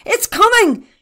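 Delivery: high, loud shout of excitement; thrilled, high-pitched yell; excited cry. A narrator's reading. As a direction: call out the news in a thrill. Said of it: "cried Barney excitedly".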